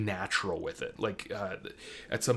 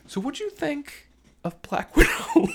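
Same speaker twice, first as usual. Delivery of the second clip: much higher voice breaking into laughter; laughing; 7 dB louder than usual. Real, not acted. Host turning from rant back to review, laughing. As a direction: burst into a high, loud laugh while asking.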